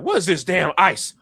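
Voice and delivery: loud, high startled outburst; Mister Five. Acted. Pirate captain seeing the ice, loud startled voice. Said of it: "Mister Five is like".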